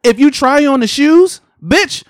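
fed-up shop worker ranting; exasperated rant. Retold or imitated. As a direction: lecture the customer like they're dense. Ranting at customers about creases.

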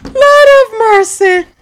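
high, loud, slow Lord of mercy; real exasperated cry; exasperated exclamation. Real, not acted. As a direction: drag it out in loud disbelief. Mock-exasperated exclamation after confession.

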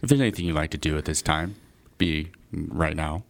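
low, soft, smooth captain announcement; calm captain over the intercom; pilot PA announcement. Shown as a demonstration. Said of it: "do it, do it".

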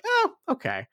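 high-pitched, easily placated little reply; meek mock Voldemort. Voldemort caving to Snape's lame excuse, high mock-meek voice. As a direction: squeak out a mock acceptance.

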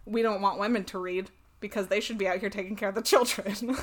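usual speaking voice

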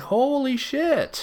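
high, drawn-out stunned reaction. Retold or imitated. Witness to a fan being cursed out, stunned. Retold shock at the yelling.

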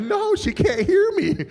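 slow, very high comic plea; exasperated protest. Retold or imitated. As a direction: protest high and drawn out, half laughing.

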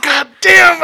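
loud, high, fed-up mock shout; exasperated shout. Friend shouting at walking past that bar again. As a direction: blurt it out in disbelief.

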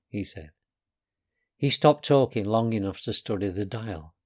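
plain reading voice

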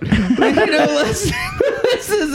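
high, breaking into laughter; laughing. Real, not acted. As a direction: laugh through the words. Host laughing while wrapping up.

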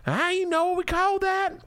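hammed-up Southern accent. Host mocks wife reading meal plans in a Southern accent. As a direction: stretch each word in a Southern drawl. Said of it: "I wish I hadn't done it in that accent".

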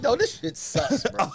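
slow, high scorn; scornful. Retold or imitated. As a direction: drag it out in disgust. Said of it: "I'm like".

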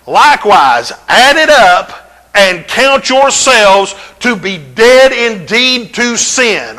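lightly proclaimed verse; scripture reading. Acted. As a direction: stretch the verse out slowly. Rereading verse paraphrased.

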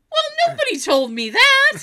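high, loud mock-dad protest; indignant outburst. Dad protesting to his child, hammed-up indignation. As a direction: imitate an outraged dad, pitch way up.

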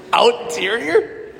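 slow, laughing retry of the word "Outerior". Real, not acted. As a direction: stretch out "Outerior" through a laugh. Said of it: "Is that even a word?".

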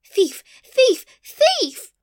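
high, loud cries climbing each time; escalating shrieked accusation; top of the voice. A narrator's reading. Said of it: "at the top of her voice".

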